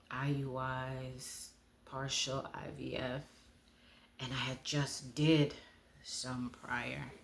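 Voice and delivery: ordinary delivery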